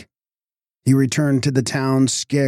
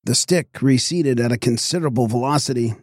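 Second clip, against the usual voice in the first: ordinary delivery, nothing marked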